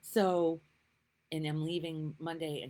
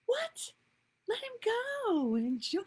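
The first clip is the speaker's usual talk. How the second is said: excited urging; 12 semitones higher, 8 dB louder than usual. Retold or imitated. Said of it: "I was like".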